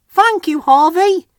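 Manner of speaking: very high cheerful peep; happy Percy. A narrator's reading. Rescued little engine thanking Harvey, very high and happy. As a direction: peep your thanks gladly. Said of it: "he peeped happily".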